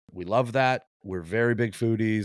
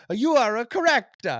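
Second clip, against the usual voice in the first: very high, loud mock Italian old woman; delighted Italian accent; 14 semitones higher, 5 dB louder than usual. Old Italian cook overjoyed at correct guess, high Italian accent. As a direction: cry out joyfully in a bad Italian accent. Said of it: "She's like".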